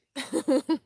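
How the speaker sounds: laugh. Real, not acted. Laughs at own remark.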